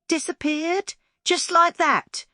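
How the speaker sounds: high, slow astonished baker's voice; surprised Mister Crumble. Acted. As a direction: stretch it out in disbelief. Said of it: "Mister Crumble said".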